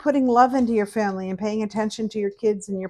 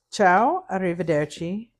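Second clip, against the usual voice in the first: usual speaking voice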